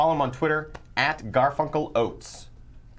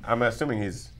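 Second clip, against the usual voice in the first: normal talking voice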